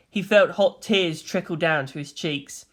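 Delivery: usual speaking voice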